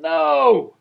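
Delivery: high, loud yelled No!; a father yelling at his sick daughter; retold yell. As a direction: shout No! at a sick child. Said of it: "I yelled".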